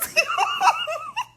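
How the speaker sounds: high shrieking laugh; squealing laugh. Real, not acted. Laughing at the drama.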